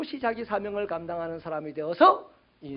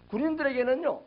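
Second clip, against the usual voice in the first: normal talking voice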